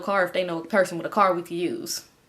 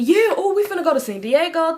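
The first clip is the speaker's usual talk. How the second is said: much higher, louder excited burst; mock-excited boyfriend; 8 semitones higher, 3 dB louder than usual. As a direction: gush about the trip like a kid. Enemy voiced excited about trip.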